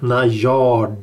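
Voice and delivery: Najard with the second A stressed and drawn out. Shown as a demonstration. Host teaching co-hosts the word, Najard stressed on final A. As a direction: lean on the last A in Najard. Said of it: "Betoning på A".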